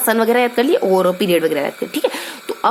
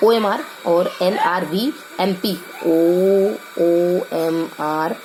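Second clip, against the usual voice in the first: slow mnemonic; 3 semitones lower, much slower than usual. Shown as a demonstration. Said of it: "tricky तरीके से चीज़ों को याद करें".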